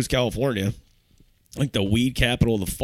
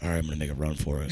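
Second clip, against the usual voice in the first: hushed scheming; 7 semitones lower, 7 dB quieter, much faster than usual. Retold or imitated. Retold sneaking out plan.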